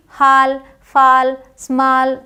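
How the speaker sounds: हाल, फाल, स्मॉल all with open "aa": "haal, faal, smaal"; mispronunciation. Shown as a demonstration. Teacher shows what to avoid, हाल, फाल, स्मॉल mispronounced with "aa". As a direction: flatten "aw" to a father-vowel in हाल, फाल, स्मॉल. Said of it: "ये नहीं बोलना".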